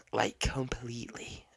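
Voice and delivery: hushed unvoiced whisper. Whispering a scandalous detail to viewers. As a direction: drop to a secret whisper.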